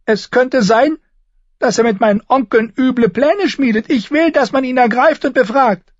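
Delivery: high, loud demanding; petulant boy king. A narrator's reading. Joffrey ordering Barristan seized, high and loud.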